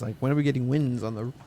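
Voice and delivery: ordinary talk, nothing marked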